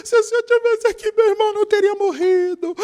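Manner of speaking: choro encenado, voz bem aguda e chorosa; Maria chorando. Retold or imitated. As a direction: imitar Maria em prantos diante do mestre. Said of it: "Maria tava chorando". (voz aguda de choro lamentoso)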